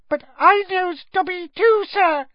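loud, very high elf squeal; squeaky elf. A narrator's reading. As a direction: pipe up eagerly in a tiny voice. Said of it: "squeaked the elf".

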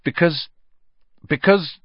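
nervous stalling. A narrator's reading. Boy searching for an excuse, nervously hesitant. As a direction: stall for an answer, haltingly. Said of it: "his heart thumping very fast".

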